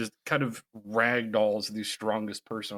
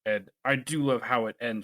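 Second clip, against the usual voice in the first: ordinary delivery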